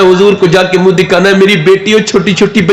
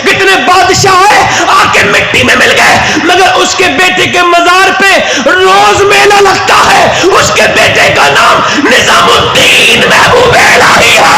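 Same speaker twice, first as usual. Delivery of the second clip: preaching at full force; 11 semitones higher than usual. Acted. Preacher to the gathering, crying out at full force. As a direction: pour it out to the crowd as a rising wail. Preacher's climax about the shrine.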